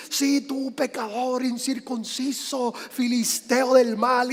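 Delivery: slow, drawn-out voice of a condemning God; mock condemning God. Preacher mimicking how we think God talks, mock condemning voice. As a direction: stretch each insult out, hammy and accusing. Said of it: "creemos que Dios habla así".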